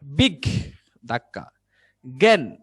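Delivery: বিগ, pause, জ্ঞান, each part pushed out; punchy, separated beats on বিগ and জ্ঞান; syllable breaks. Shown as a demonstration. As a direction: break the word after বিগ, then hit জ্ঞান. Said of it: "এই ধাক্কা কোথায়?".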